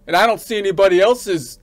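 normal talking voice

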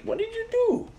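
high-pitched mock voice asking; imitated questioning. Retelling his look at the speaker, imitated high-pitched questioning. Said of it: "he was like".

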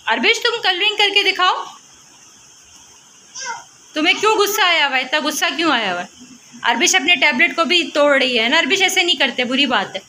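high coaxing mom voice. Real, not acted. Mother coaxing her angry daughter to draw, high and sing-song.

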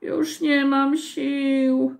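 slow, high, worn-out whine; drawn-out feeble high moan. A narrator's reading. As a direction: groan it out with no strength left. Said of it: "jęczał wyśmiewaczek".

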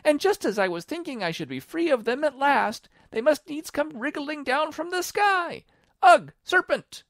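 very high, loud pigeon shriek. A narrator's reading. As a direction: build to a disgusted shriek. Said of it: "raising its voice to a shriek".

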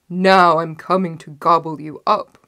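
loud, menacing troll voice; roaring troll. A narrator's reading. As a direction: threaten to devour, loudly. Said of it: "roared the troll again".

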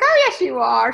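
loud, playful overruling of her refusal; loud insistence. Retold or imitated. To her client who refuses to get dirty, loud playful insistence. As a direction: shut down the excuse with a loud cheerful yes.

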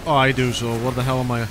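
normal talking voice

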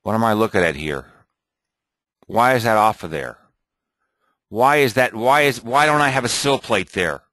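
ordinary talk, nothing marked

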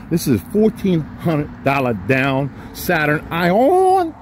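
slow, stretched-out price announcement; drawn-out sales pitch. Acted. Dealer slowly announcing the Saturn price to viewers, drawn out. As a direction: savor every word of the deal, slowly.

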